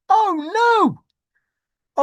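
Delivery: high, loud "oh no"; mock wail. Mock wailing as the car rolls through. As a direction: wail it out, hammed up.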